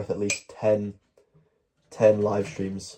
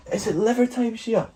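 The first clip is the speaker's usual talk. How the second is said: high dog voice; 12 semitones higher than usual. Real, not acted. Talking to the dog.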